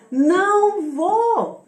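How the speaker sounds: "Não vou" stretched long, high and loud; "Não vou" as a loud, slow, ringing refusal; emphatic refusal. Real, not acted. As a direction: draw out both words of "Não vou" forcefully. Emphatic refusal closing a list.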